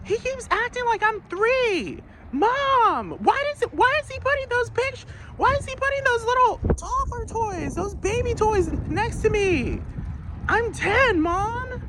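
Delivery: put-on very high, whining kid complaining to mom; child whining about his dad's songs; whiny ten-year-old. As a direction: tattle like a sulky kid. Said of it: "That's what a ten-year-old would do".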